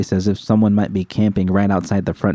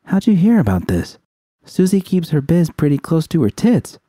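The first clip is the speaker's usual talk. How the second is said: put-on higher, pointed young woman's question; curious Judy; 9 semitones higher than usual. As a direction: press her for the source.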